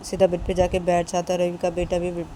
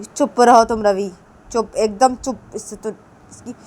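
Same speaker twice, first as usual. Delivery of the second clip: stern scolding; 3 semitones higher, 6 dB louder than usual. Acted. Shruti silences Ravi with a loud scolding. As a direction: shut him up with a loud telling-off.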